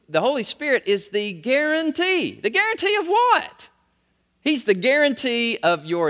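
high, loud preacher's cry; ringing pulpit call; preaching at full force. Acted. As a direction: lift the question to the congregation. Preacher's rising call-and-response.

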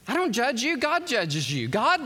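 usual speaking voice

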